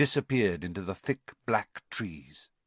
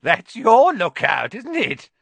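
high, loud voice cracking with glee; gleeful cackling caretaker; 13 semitones higher, 10 dB louder than usual. Acted. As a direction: relish their fear, voice cracking. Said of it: "his voice cracking with glee".

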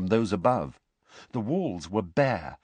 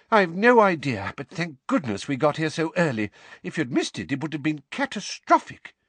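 worried; 6 semitones higher than usual. A narrator's reading. Weasley rushing, worried.

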